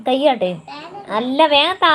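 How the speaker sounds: very loud, high-pitched demand; impatient shouting. Real, not acted. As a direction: shout an urgent order off-mic. Demanding something from someone off stream. (അക്ഷമയോടെ ഉച്ചത്തിൽ അലറുന്നു)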